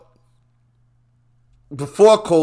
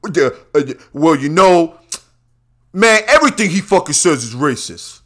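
mocking voice; 4 semitones higher, 5 dB louder than usual. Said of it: "Tell me something racist about Trump".

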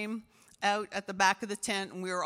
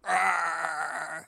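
short, loud growl to the mirror; mock roar to pump yourself up; power roar; 3 semitones lower, 4 dB louder than usual. Shown as a demonstration. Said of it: "get in front of the mirror and go".

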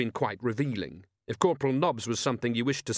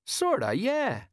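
slow, drawn out and much higher; slowly; 13 semitones higher, slower than usual. Acted. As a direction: drag it out while thinking. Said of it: "said Colon slowly".

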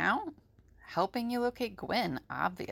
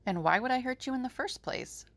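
soft, quick, higher character voice; amused; 6 semitones higher, 5 dB quieter, faster than usual. Acted. Allie answers frightened Gary, amused. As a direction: toss it back lightly, amused. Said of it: "who looked quite amused".

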